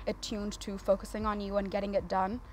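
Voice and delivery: ordinary talk, nothing marked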